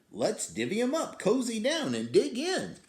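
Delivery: high, quick, eager badger voice; grinning badger. Badger sharing honey nuts, high and eager. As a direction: say it fast with a grin. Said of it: "Badger says with a grin".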